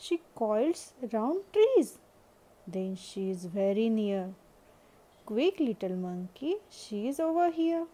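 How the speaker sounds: loud, hurried eager call; butterfly urging monkey excitedly. A narrator's reading. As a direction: rush him along, she's near.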